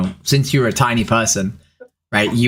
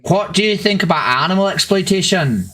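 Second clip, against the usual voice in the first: higher-pitched Northern Irish lilt; Northern Irish accent; 6 semitones higher than usual. Acted. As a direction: put on a Belfast accent. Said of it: "told to do a Northern Irish accent".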